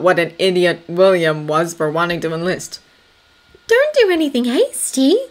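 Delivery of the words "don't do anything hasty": high, urgent caution; warning. A narrator's reading. Anna stopping Thomas from enlisting rashly, warning. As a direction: caution him firmly in a raised, high voice. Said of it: "she warned him".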